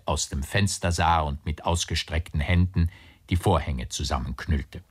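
ordinary delivery, nothing marked

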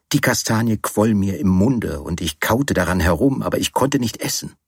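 plain reading voice

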